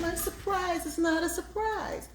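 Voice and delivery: high, bright mock dismay; surprised squeal. Real, not acted. Cheese burst out of meatballs.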